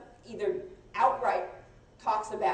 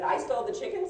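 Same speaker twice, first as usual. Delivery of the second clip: raised pitch, rising like a shocked question; incredulous question; 5 semitones higher than usual. Retold or imitated. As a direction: deny it by asking it back in disbelief. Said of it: "there's no inflection in email".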